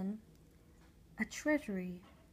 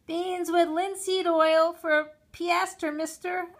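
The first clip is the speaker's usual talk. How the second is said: put-on boy ordering; 9 semitones higher, 12 dB louder, slower than usual. A narrator's reading. Boy orders beans from seller.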